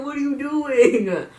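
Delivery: "What are you doing?" shouted high, loud and slow; incredulous yell. Shown as a demonstration. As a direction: belt it out in stunned disbelief, drawn out. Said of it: "It didn't make it".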